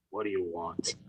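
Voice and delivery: low, quiet, gruff "what do you want"; a bored school disciplinarian grunting at the kid. Retold or imitated. Disciplinarian to student barging into his office, low and gruff.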